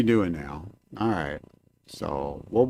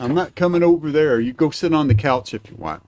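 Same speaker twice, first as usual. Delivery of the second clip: usual speaking voice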